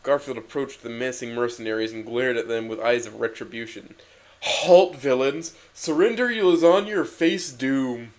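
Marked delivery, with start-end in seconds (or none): 4.4-8.1 louder, higher hammed-up battle cry; mock heroic rage; fiery roar. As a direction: challenge the villains at full voice. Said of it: "roared Garfield with fiery rage".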